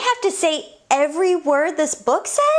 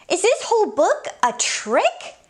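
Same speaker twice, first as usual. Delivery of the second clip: ordinary delivery, nothing marked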